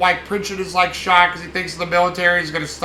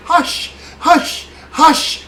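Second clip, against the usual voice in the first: creepy hushing; 10 semitones higher, 6 dB louder, much slower than usual. Retold or imitated. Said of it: "you know, shut up".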